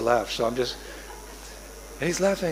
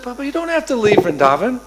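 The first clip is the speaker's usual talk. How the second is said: put-on higher, pleading little boy; childlike plea; 7 semitones higher than usual. Little Krishna coaxing his father to stay, pleading child. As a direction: coax Papa to stay.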